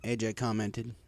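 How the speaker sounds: ordinary delivery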